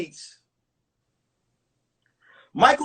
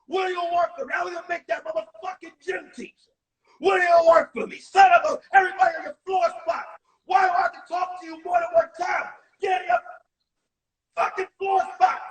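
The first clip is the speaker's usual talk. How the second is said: high, loud hammed-up furious yelling; cursing gym teacher; 7 semitones higher, 5 dB louder than usual. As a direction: explode at the class and the wife on the phone. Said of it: "He's like".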